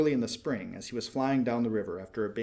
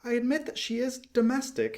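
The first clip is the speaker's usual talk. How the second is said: much higher, light bird voice; a small bird, peeved and pouting; little swallow; 12 semitones higher than usual. A narrator's reading.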